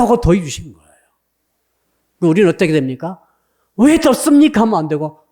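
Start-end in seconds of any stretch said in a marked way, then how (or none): 3.8-4.6 high-pitched complaining question; mock protest. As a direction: challenge it in a raised, put-on voice. Models the wrong, complaining response.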